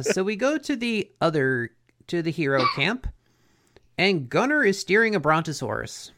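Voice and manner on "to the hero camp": ordinary delivery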